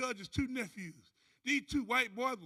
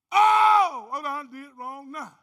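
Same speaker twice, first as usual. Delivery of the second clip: dismayed shout; 2 semitones higher, 14 dB louder, slower than usual. Acted. Man realizing he made the wrong choice, dismayed shout. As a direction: cry out at your own mistake.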